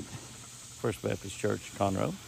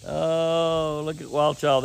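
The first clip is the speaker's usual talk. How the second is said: playful exclaiming; 6 semitones higher, 7 dB louder, slower than usual. Real, not acted. Playful exchange with wife.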